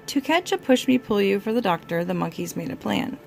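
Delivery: usual speaking voice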